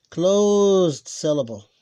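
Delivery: slow, stressed closed syllable; drawn-out term. Real, not acted. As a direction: stretch the first word long and loud. Spelling out the term while writing.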